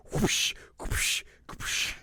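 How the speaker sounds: unvoiced, airy swooshes; whoosh noises. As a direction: imitate blows flinging people aside. Imitates Beerus flinging them around.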